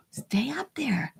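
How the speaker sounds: slow, breathy whisper to the object; will it to stay put under your breath; hushed plea to the shelf. Real, not acted. Coaxing a teetering object.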